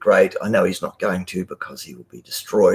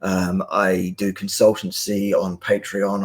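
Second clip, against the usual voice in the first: ordinary talk, nothing marked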